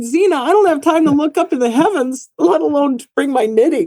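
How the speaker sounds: high-pitched mock exasperation; exasperated retort. Telling the visitor she has no time, mock exasperated.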